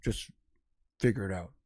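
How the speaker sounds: imitated low, dismissive neighbor; very low, understated parting jab; passive-aggressive neighbor. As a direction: drop way down and brush them off. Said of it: "he does this passive aggressive thing".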